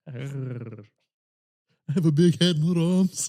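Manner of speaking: slow, drawn-out cartoon dinosaur voice. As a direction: play a dopey dinosaur quote, dragging the words. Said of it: "he's like a dinosaur".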